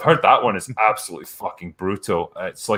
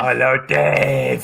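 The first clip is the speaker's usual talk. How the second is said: slow, drawn-out character greeting; impersonated sketch character, words dragged long; Papa Lazarou; 3 semitones higher, much slower than usual. Acted. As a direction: stretch the name out in a put-on comic voice. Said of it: "Papa Lazarou turns up".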